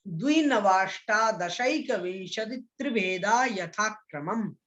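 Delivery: the whole sutra in one flowing, steady recitation; recited in one go. Shown as a demonstration. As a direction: chant it through without breaking. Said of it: "एक साथ मैं इसका उच्चारण कर रहा हूं".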